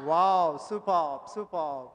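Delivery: loud delighted wow; big teacher's wow of praise; excited wow. Real, not acted. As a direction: cheer the class on. Praising after the count.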